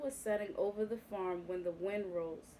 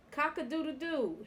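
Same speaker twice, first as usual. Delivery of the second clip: chuckling crow; 6 semitones higher than usual. A narrator's reading. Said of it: "he chuckled again".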